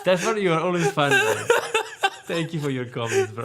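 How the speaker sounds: loud laughing thanks; laughing through the words. Real, not acted. Laughing at viewer comment.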